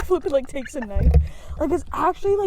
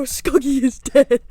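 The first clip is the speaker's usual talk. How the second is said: mock announcement; 6 dB louder, slower than usual. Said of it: "I go".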